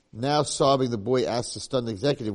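usual speaking voice

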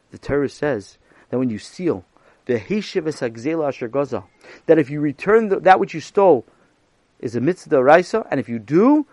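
ordinary delivery, nothing marked

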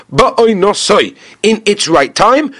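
usual speaking voice

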